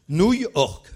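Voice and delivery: "Nouille orque" high and very loud; triumphant loud burst on "Nouille orque"; punchline reveal. Real, not acted. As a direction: proclaim "Nouille orque" as the big answer. Reveals the pun answer.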